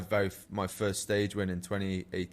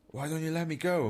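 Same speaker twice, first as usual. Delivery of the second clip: mock pleading rider; 8 semitones higher than usual. Retold rider pleading with the team.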